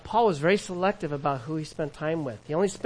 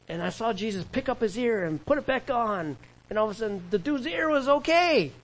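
acted astonishment; 5 semitones higher than usual. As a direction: voice climbing high in disbelief. Acting Peter amazed at healed ear.

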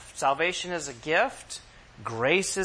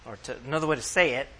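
ordinary talk, nothing marked

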